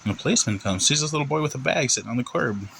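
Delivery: ordinary delivery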